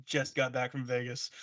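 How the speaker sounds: soft, flat restatement; mumbled deadpan; deadpan reply. Retold or imitated. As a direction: drop it quietly, matter-of-fact. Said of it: "I'm like".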